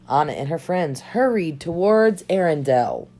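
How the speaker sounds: ordinary delivery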